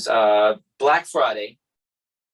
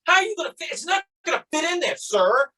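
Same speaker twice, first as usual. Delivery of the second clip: very high-pitched sarcastic outburst; retold furious retort. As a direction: re-enact losing your temper at a cop, voice shooting up. Retold furious retort while slamming TV.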